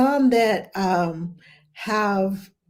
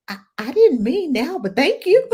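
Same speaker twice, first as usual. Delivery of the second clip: higher-pitched, rising polite protest; retold awkward thanks; 6 semitones higher than usual. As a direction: imitate a sheepish thank-you, voice climbing.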